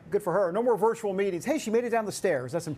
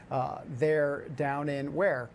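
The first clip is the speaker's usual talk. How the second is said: usual speaking voice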